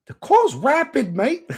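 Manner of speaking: high, loud, drawn-out English impression; mock English accent. An Englishman thrilled by the fast car, mock English accent. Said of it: "he said some English shit".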